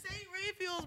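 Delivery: Raphael's insisted slowly as "RAY-feels"; mispronounced saint's name. Real, not acted. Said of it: "pronouncing the name of the school wrong".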